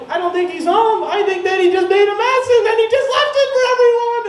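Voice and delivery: very high, loud imitation of a furious girlfriend; enraged screaming. Girlfriend complaining about the roommate's mess, enraged screaming. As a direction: shriek it so the whole house hears. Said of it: "is enraged, like Dante's Inferno has been lit inside her".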